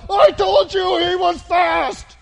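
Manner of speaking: drawn-out, high-pitched shout at a player; full-force coach's scolding yell; angry coach shouting. Pretend. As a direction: bellow it slowly like a furious coach. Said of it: "What's the coach gonna do?".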